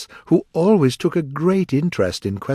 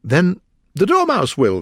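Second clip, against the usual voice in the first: loud, higher-pitched exclamation; crying out; 9 semitones higher, 6 dB louder than usual. A narrator's reading. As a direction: call it out insistently. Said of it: "they both cried".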